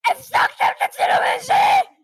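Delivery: outraged shout; angry yell. Acted. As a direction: yell at them for ruining the shoot. Director yelling at actors.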